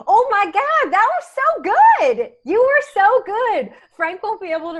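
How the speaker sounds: squealing high and loud; excited. Real, not acted. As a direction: gush with delight, pitch way up. Thrilled after the prank.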